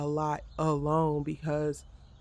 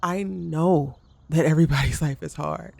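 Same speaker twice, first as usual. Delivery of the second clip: exasperated retort; 7 dB louder than usual. Real, not acted. Retorting to family member's dismissal.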